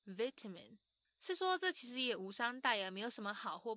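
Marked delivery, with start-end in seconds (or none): 0.0-0.8 British vitamin, VIT-a-min; 英式发音的vitamin; British pronunciation. Shown as a demonstration. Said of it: "但是英式说法就会变成是".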